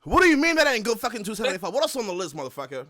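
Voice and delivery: fast, loud, high mock-angry demand; mock outrage. Cohost mocking the guest's weight remark, loud fast mock anger. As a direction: ham up fury at the guest.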